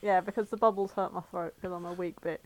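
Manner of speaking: ordinary talk, nothing marked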